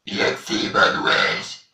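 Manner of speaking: "Yaxi Manouesh" belched out slowly; slow burped phrase. Shown as a demonstration. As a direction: drag "Yaxi Manouesh" out on a burp. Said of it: "en rotant".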